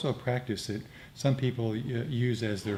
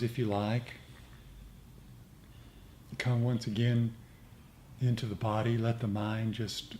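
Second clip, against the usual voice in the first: guided meditation; 5 dB quieter than usual. Acted. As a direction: lead the group gently into metta. S0 leads metta meditation.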